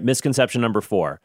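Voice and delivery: ordinary talk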